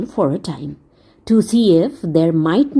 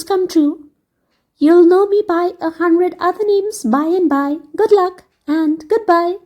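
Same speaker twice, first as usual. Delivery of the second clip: higher, light fairy lady voice; merry fairy farewell; 8 semitones higher than usual. Acted. Fairy lady saying goodbye to Dick, light and fond. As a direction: bid the boy goodbye fondly.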